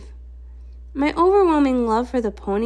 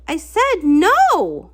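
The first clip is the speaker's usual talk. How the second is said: slow, high, louder shout of no; climactic shout; 6 semitones higher, 5 dB louder, much slower than usual. Acted. Cadance repelling Prismia with love, a slow loud shout. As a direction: draw out the refusal with full force.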